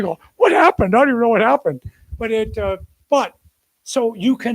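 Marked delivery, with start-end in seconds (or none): 0.4-1.8 high, surprised outburst on What; wide-eyed disbelief; baffled surprise. Retold or imitated. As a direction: sound stunned and bewildered. Baffled, pitch jumps high.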